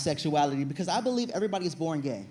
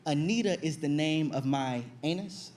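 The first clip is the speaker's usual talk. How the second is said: usual speaking voice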